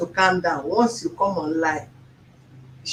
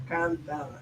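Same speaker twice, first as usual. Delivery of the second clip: calming self; 3 semitones lower, 8 dB quieter than usual. Real, not acted. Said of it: "I want to be calming down".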